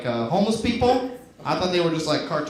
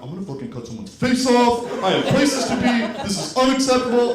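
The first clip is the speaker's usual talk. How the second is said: mock rage; 4 semitones higher than usual. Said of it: "I get that mad".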